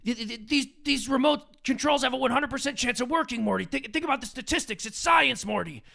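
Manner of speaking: know-it-all mad scientist lecturing, cracking up; Rick impression. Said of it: "Rick would go".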